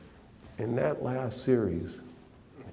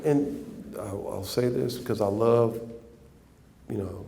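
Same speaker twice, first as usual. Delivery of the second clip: usual speaking voice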